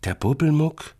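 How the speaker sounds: high, disbelieving question; incredulous. A narrator's reading. Little brother questions sister's creature, incredulous. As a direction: echo the name in childish disbelief. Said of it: "fragt Florentin ungläubig".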